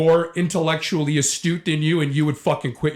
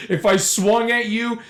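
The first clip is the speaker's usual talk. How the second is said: slow, high-pitched mimicry with a laugh; mocking echo; 7 semitones higher, slower than usual. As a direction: mimic the officer in derision. Mockingly repeats officer after laughing.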